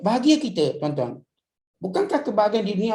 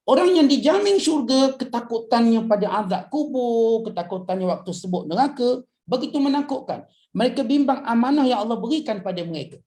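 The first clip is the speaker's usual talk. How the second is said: impassioned preaching; 4 semitones higher than usual. Acted. Preacher stirs the congregation with fear of hellfire, impassioned. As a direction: drive home the fear of the grave.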